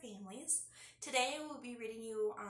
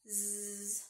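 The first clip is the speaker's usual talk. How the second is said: snoring; 4 semitones lower, 3 dB quieter than usual. Acted. Turtle asleep.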